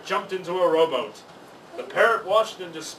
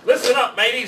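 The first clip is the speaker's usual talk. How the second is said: gruff pirate call to his mate, raised; pirate captain; 6 semitones higher, 6 dB louder, faster than usual. A narrator's reading. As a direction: hail the parrot like a rough sea captain. Pirate captain addresses parrot.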